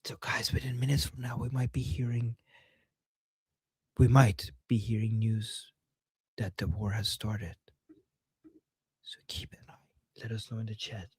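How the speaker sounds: hushed whisper to the viewers. Real, not acted. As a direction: whisper it like a secret. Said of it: "[name], why are you whispering?".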